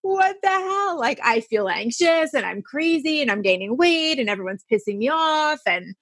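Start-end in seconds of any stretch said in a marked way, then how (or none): 0.0-1.0 high, thrown-up "what the hell"; retold baffled cry; mock shock. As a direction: pitch it way up in disbelief. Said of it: "You're like".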